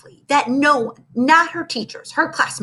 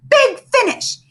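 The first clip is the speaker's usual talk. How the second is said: high, loud conductor's call; a loud conductor's holler; 13 semitones higher, 4 dB louder than usual. A narrator's reading. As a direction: holler it over the band.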